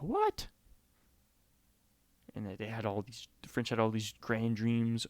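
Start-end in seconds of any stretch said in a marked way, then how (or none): 0.0-0.5 very high-pitched incredulous squeak; mock surprise. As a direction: act out stunned disbelief, pitch leaping up. French shocked by the news.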